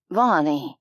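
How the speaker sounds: low, hesitant man's voice; uncertain. A narrator's reading. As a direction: say her name unsure. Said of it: "his tone radiating uncertainty".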